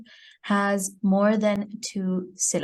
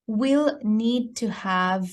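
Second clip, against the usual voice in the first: ordinary talk, nothing marked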